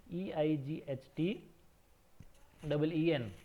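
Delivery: letter by letter, slowly; slow careful spelling. Shown as a demonstration. Teacher spelling for kids, slow and clear. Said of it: "सावधानी से इस spelling को आपको याद करना है".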